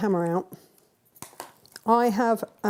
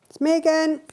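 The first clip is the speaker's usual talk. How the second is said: loud; 9 semitones higher, 5 dB louder than usual. Real, not acted. Hammering, apologising to the neighbour.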